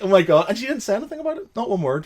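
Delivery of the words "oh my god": mortified shock. Retold or imitated. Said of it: "I was just like".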